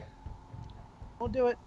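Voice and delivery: breathy whispered refusal; hushed childish refusal; mock defiant whisper. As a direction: whisper it stubbornly.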